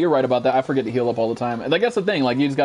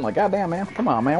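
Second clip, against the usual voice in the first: ordinary talk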